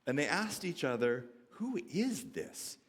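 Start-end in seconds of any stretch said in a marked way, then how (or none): 1.5-2.8 hushed, slow question, pitched higher; a touch of acted awe and fear; mildly terrified. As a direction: ask it slowly, in understated fear. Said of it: "Their response was to be terrified".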